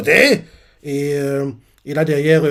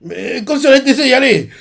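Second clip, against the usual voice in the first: annoyed retort; 8 semitones higher, 5 dB louder than usual. Retold or imitated. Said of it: "Usopp, il est énervé".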